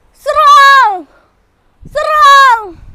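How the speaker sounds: shouted high charge order; battle cry. Acted. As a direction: yell the charge.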